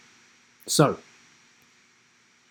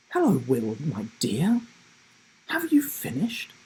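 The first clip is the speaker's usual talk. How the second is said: put-on a gentle old granny checking on Will; Grandma Rivers. Grandma Rivers voiced in story.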